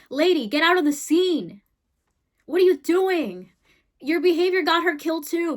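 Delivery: exasperated scolding. Real, not acted. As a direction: tell her off from afar. Scolding the woman.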